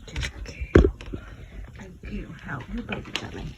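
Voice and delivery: whispered praise to the cat. Real, not acted. Owner whispering encouragement to her labouring cat. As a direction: breathe the words to her.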